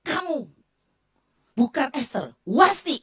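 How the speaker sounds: loud, cutting mock rebuke; retold harsh rebuke. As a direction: fling the verdict out loud and sharp. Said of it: "Dan tahu saya dibilang apa?". (teguran keras yang ditirukan, lantang)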